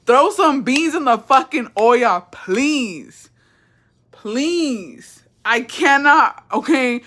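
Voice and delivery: high-pitched, loud begging; exasperated pleading. Real, not acted. Exasperated begging about the cooking.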